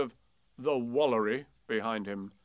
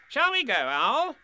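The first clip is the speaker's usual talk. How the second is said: bustling rabbit voice; 9 dB louder than usual. Acted.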